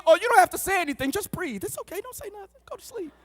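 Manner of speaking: high, hushed sweet-talk; lovestruck soothing. Retold or imitated. Lovestruck caller soothing his girlfriend.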